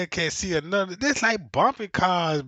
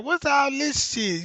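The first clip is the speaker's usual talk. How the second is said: mock confused outrage; 9 semitones higher, 3 dB louder than usual. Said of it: "I'm like".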